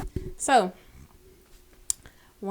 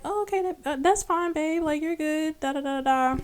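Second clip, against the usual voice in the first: lightly voiced boyfriend, higher and breezy; mimicking boyfriend; 9 semitones higher than usual. Imitating her boyfriend brushing off her exhaustion, higher and breezy. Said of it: "And he was like".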